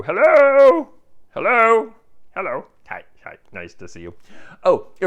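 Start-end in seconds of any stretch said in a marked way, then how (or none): 0.0-2.7 calling out high and loud, hello?; hello? sung out loud and high to the camera; mock hello calls. To viewers, checking anyone's there, loud high call. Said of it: "maintain my energy level". 2.9-4.1 soft whispered hi, hi; whispered greeting. Pretend. Whispered greeting after loud hellos.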